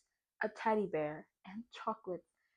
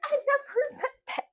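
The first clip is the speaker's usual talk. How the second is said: much higher, laughing disbelief; laughing mock protest. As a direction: squeak out the denial. Her retort, high and laughing.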